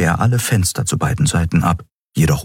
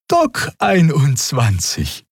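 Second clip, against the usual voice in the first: higher, slow friendly answer; amiable; 9 semitones higher, slower than usual. A narrator's reading. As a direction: answer kindly and high. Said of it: "antwortete Xea liebenswürdig".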